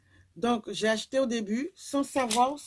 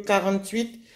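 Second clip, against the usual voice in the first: ordinary talk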